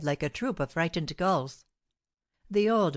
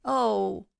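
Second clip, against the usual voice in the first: hoarse cry; 5 semitones higher than usual. Retold or imitated. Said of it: "He cried out hoarsely thus".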